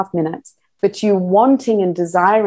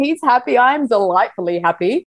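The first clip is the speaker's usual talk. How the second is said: ordinary delivery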